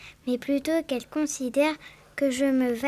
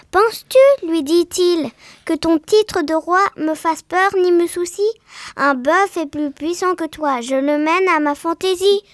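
defiant gnat shouting; 3 semitones higher, 7 dB louder than usual. Acted. The gnat shouts his challenge at the lion, loud and defiant. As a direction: shout the gnat's dare at the king.

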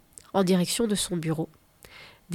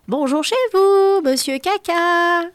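very high, loud, slow crowd greeting; a noisy gang hollering a hello; big family swarming the beach, calling high and slow; 9 semitones higher, 7 dB louder, slower than usual. Acted. A crowd bursts onto the beach.

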